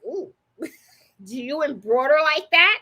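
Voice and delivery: slow, drawn-out, lightly mocked customer; dubious customer. Customers asking about her embroidery, lightly mocked doubt. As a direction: imitate a wary customer, laughing after the "ooh".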